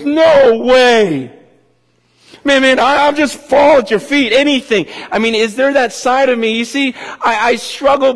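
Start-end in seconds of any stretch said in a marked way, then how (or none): 0.0-4.0 high, loud, breathless No way; awed disbelief. Retold or imitated. Woman at Jesus' feet, stunned and amazed. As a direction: gasp it out in amazement. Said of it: "that woman that just goes".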